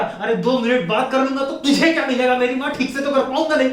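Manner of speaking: comic exasperated outburst; acted exasperated retort. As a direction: plead and snap back, fed up. Acted phone quarrel with girlfriend.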